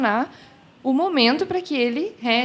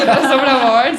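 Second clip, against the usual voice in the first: laughing through the words, quick; fast giggly repeat of a joke title; 3 semitones higher, 9 dB louder, much faster than usual. Real, not acted. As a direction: blurt it out mid-laugh.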